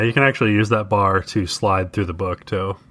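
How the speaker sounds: ordinary talk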